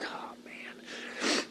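whispered, breathy dread; hushed, awed dismay. Retold or imitated. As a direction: let it out as a bare whisper. Dread as storm moves in.

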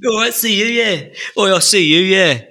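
high, hammed-up Cockney farewell; Cockney goodbye. Host bidding co-host goodbye in a mock Cockney accent. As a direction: play a cheerful Cockney geezer waving off. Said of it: "when you do a Cockney accent".

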